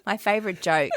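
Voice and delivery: ordinary talk, nothing marked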